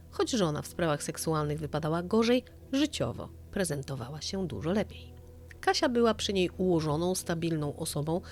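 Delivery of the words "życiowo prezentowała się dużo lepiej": ordinary delivery, nothing marked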